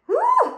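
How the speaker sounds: loud, very high "woo"; high whoop. Real, not acted. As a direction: whoop to shake off the tears. Shaking off sudden tears.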